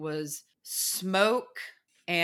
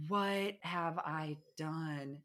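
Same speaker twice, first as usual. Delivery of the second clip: soft, very slow, barely voiced regret; dismayed murmur; 9 dB quieter, much slower than usual. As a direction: mutter it to yourself in dread. Her overwhelmed younger self.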